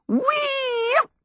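very high, drawn-out whoop; gleeful shout. A narrator's reading. Michael whirls Sophie into the chair, long high whoop. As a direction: whoop as you swing someone round. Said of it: "Michael shouted".

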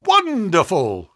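high, drawn-out exclamation; delighted cry. A narrator's reading. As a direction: marvel at him, stretching the word. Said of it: "he cried".

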